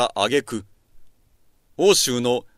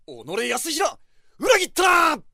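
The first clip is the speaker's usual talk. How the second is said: furious shout. Acted. Ambushed lord cries betrayal.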